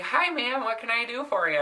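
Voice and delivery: high, sugary customer-service voice; overly polite. Retold or imitated. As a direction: sweetly ask how you can help. Sweet reply to demanding lady.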